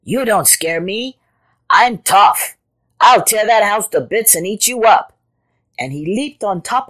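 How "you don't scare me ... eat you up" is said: louder, defiant leopard; tough boasting. A narrator's reading. Leopard challenging the hidden animal, loud defiance. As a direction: threaten back boldly.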